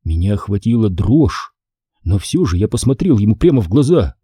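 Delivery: ordinary delivery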